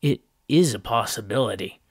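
plain reading voice

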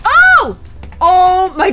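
sudden loud high cry; startled cry. Real, not acted. Gamer hit by a creeper blast, startled cry. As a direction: jolt at the explosion.

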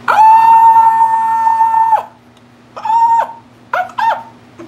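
sharp, loud, high yelping screams like a dog; barking shriek. Real, not acted. Opening her package, she yelps like a barking dog. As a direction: yelp with pure excitement over the setting spray. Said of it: "I sound like a dog".

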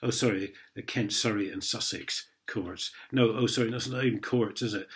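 normal talking voice

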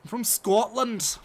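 loud, slow, high-pitched Scottish parody; bad Scottish accent. Streamer mimicking a game character, in hammed-up Scottish. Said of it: "I can't do a Scottish accent".

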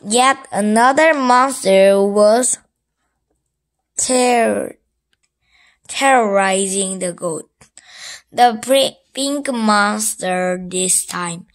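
ordinary delivery, nothing marked

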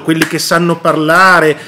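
ordinary talk

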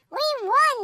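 high, bright burst of triumph; proud yelp. A narrator's reading. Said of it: "they yelped with pride".

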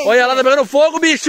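high, loud alarmed call; excited shout. Real, not acted. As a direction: shout it as the fire catches. Fire flares up, calling out.

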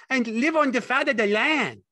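very high, loud, gleeful simple fellow; Lenny's happy excitement. A narrator's reading. Lenny happy about the farm, high and loud. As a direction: crow it with childlike happiness. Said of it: "Lenny giggled with happiness".

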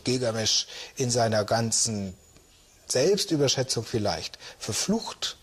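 ordinary talk, nothing marked

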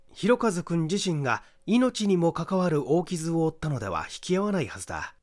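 ordinary delivery

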